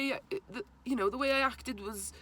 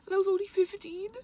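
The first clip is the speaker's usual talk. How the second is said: emotion rising; 9 semitones higher, 4 dB louder than usual. Real, not acted. Emotional recollection of father's death.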